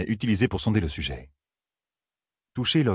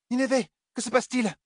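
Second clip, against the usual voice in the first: crying out. A narrator's reading. Elaine calls to Nineveh as she recoils, crying out high. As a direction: cry out in a high-pitched voice. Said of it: "s'écria Elaine".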